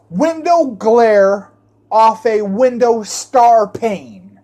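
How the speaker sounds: ordinary talk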